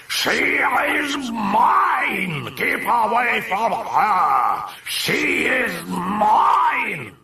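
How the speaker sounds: drawn-out demonic roar; coarse, powerful bellow. A narrator's reading. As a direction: roar possessively, high and very slow. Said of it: "bellowed in a coarse and powerful voice".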